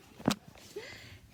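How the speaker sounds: breathy laugh. Real, not acted. Laughs mid-list.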